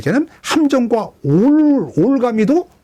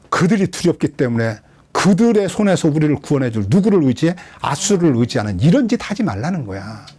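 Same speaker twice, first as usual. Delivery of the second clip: ordinary talk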